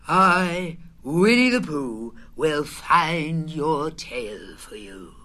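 solemn. A narrator's reading. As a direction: pledge it like a knight taking an oath. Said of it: "he said solemnly".

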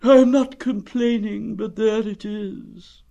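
high, slow, resigned; gloomy donkey. Acted. Donkey insisting he is not complaining, gloomy. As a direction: draw it out with self-pity.

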